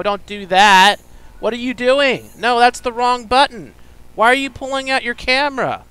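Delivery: high, loud panicked yelling at the screen; frantic yelling. Real, not acted. Gamer yelling at his character in panic. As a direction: shout in panic as it all goes wrong. Panicking as game goes wrong.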